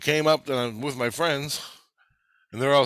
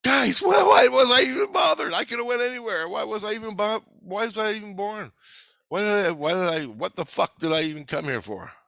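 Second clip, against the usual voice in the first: anguished complaint; 9 semitones higher than usual. Retold or imitated. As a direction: wail why at the friends, then sink. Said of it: "Then I'm saying".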